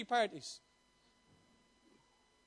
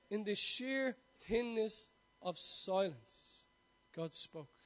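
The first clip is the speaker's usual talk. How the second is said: slow, much softer, almost breathless on God spoke; hushed whisper; 3 semitones lower, 7 dB quieter, much slower than usual. Shown as a demonstration. Preacher repeating the line to the congregation, whispered. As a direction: draw the listener into the silence. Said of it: "Doesn't that sound mystical?".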